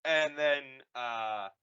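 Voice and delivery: normal talking voice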